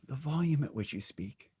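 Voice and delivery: hushed demonstration of volume; quiet demo. Shown as a demonstration. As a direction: drop the voice right down to a murmur. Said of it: "Do you speak like this?".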